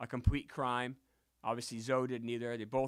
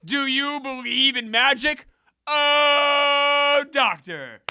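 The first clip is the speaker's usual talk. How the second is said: high, drawn-out victory holler; excited catchphrase shout; 13 semitones higher, 14 dB louder, much slower than usual. Real, not acted. Streamer celebrating a nice win, ecstatic shouting. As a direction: belt it out, stretching the big exclamation.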